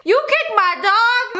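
high, slow outraged echo; mock offended high voice. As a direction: repeat it like the cartoon voice. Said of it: "echoing the prank voice".

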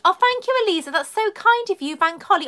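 high, sing-out thank-you to a donor; gushing thanks. Real, not acted. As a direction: break off to thank them sweetly. Thanking donors mid-sentence.

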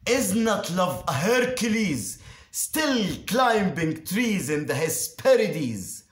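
impassioned declamation. Acted. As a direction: build the verse into an exalted outburst. Rhetorical climax praising love.

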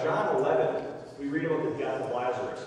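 ordinary talk, nothing marked

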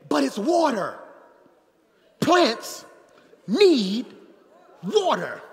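loud, high preacher punchline; hammed-up shouted reasoning; mock-logical shout. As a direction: argue it like it's obvious. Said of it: "I said".

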